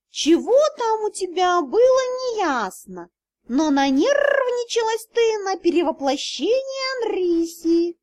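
high, slow cat drawl; cat spirit telling the girl why. Acted. Cat explains.

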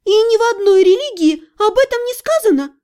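high, protesting doubt; doubtful. A narrator's reading. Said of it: "усомнилась я".

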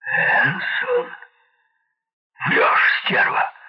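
hoarse, loud outburst rising in pitch; incredulous, rough shout; angry disbelief. A narrator's reading. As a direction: spit out a furious accusation. Hogan disbelieves and insults Meg.

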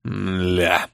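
low, soft, stretched-out curse; drawn-out groan. A narrator's reading. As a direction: drag it out low and deflated. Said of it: "публично оплёванный кувалда протянул".

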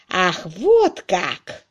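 long, drawn-out cry of realization; exclaiming. A narrator's reading. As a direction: exclaim it slowly, with emphasis. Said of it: "вскричал Макбрайт".

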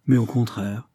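ordinary delivery

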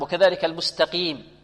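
ordinary delivery

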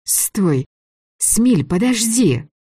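rising panicked plea, slowing down; frightened pleading. A narrator's reading. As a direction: beg him to stop, scared. Girl resisting, pleading.

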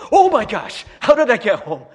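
loud, high startled outburst; loud disbelieving cry; shocked panic. Retold or imitated. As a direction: blurt it out in alarm on waking up. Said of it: "and I was like".

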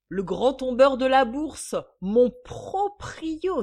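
high, loud, stretched-out announcement; gloating showmanship. Acted. Mistress boasting about her banker landlord, gloating. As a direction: draw it out with mocking pomp.

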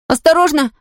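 high alarmed shout. Acted. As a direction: yell a warning. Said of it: "крикнул Том".

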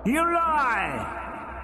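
high, hollow thunder; thundering accusation. A narrator's reading. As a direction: hurl the accusation. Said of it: "the voice thundered".

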